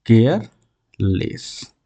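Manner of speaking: drawn-out careless; teacher slowly spelling out a new word; slow demo word. Shown as a demonstration. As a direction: stretch each sound out slowly. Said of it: "तो हम जोड़ेंगे तो क्या हो जाएगा?".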